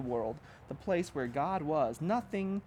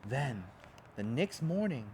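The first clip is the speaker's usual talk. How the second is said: usual speaking voice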